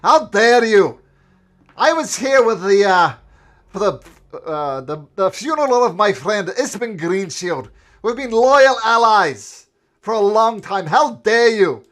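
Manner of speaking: loud, high furious yelling; outraged shout. Acted. Mayor shoved in the chest, yelling outraged. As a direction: explode with offended fury. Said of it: "she says".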